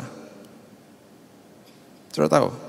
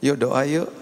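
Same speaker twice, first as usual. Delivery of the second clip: normal talking voice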